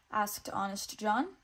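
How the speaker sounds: plain reading voice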